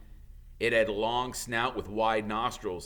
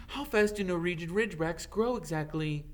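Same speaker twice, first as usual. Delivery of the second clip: higher, prim schoolgirl question; a lightly voiced clever girl asking; Hermione's voice; 8 semitones higher than usual.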